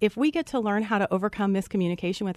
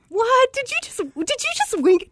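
astonished. Retold or imitated. Hiker stunned when a dog winks, high astonished cry. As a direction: gasp it out as if the dog really winked.

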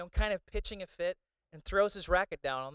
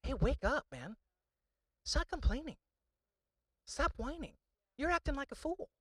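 a lightly voiced scolding God, higher; a hint of God rebuking the complainer; God's voice; 5 semitones higher than usual. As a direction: gently chide like God waking a fool. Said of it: "He has sent a tennis ball to my forehead to say".

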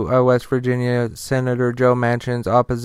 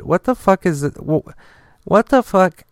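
stunned disbelief; 6 semitones higher than usual. Real, not acted. Reacting to governor showing dog.

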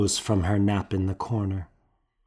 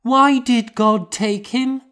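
put-on high, loud little girl asking why; a hurt child demanding an answer; grieving child; 5 dB louder than usual. A narrator's reading. Grieving child questions God.

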